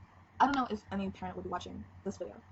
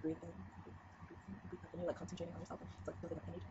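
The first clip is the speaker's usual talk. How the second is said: softly guiding the breath, much quieter; soft breathing demo; 2 semitones lower, 12 dB quieter than usual. Shown as a demonstration. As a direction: hush down as if leading an exercise. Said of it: "It's like, you have to".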